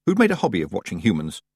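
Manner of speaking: plain reading voice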